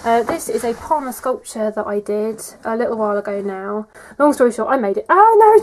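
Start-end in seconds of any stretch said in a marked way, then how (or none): 5.1-5.6 high, loud startled yelp; sudden oh-no; surprised cry. Real, not acted. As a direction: yelp as the sculpture topples. Sculpture falls over.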